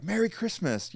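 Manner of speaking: high, bubbly holiday cheer; super excited greeting. Retold or imitated. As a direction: chirp it like an overeager clerk. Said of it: "they're all super excited".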